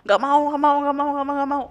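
high-pitched wailing refusal; mock crying. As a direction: imitate a child's rapid tearful protest. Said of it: "Gue nangis".

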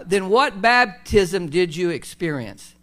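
slow, deliberate scripture reading; measured pace, word by word. Real, not acted. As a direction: read it out slowly and emphatically. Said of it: "let me slow down".

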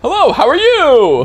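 very high, loud, gushing hello; hammed-up delight at meeting someone; mock overfriendly greeting. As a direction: overdo the friendliness, way up high. Skit of overeager friendly greeting.

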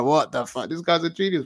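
amazed. Retold or imitated. Teen self amazed at the show.

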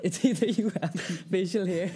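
giggling while talking; laughing. Real, not acted. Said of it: "laughing through the line".

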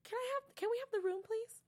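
high, sweetly awkward ask; squeaky polite plea; awkward request. Retold or imitated. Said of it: "I love how she's like".

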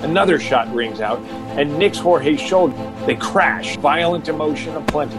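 usual speaking voice

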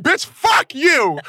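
high, loud, slow yell; retold angry comeback. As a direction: stretch out a re-enacted yell. Re-enacting his yelled comeback.

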